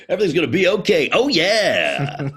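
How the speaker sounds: high, loud cartoon hype-man; Duff Man. Cheering up a caller having a bad day, booming mascot voice. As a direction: belt out comfort like a beer mascot. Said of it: "Can you just do Duff Man".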